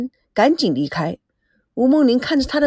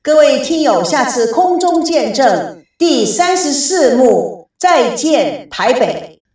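slow, louder announcer read; stately slow broadcast outro; radio host sign-off; 5 dB louder, slower than usual. Acted. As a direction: draw out the closing disclaimer for the audience. Closing announcement to listeners.

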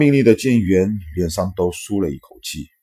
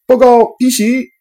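high urgent shouted report; enemy spotted shout; 9 dB louder than usual. A narrator's reading. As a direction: yell the alarm out. Radar operator spots attack.

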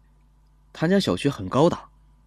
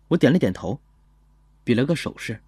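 usual speaking voice

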